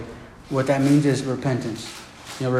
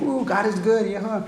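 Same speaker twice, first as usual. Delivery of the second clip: high, soft celebratory whoop; imagine Jonah cheering in a small high voice; mock jubilation; 5 semitones higher, 4 dB quieter, slower than usual. Imagines Jonah jumping for joy.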